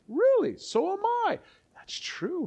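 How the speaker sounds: very high-pitched, drawn-out surprise; mock amazement. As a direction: squeal it in hammed-up delighted astonishment. Acts out delighted reply in conversation.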